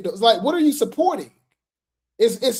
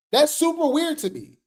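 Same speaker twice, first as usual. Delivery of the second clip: usual speaking voice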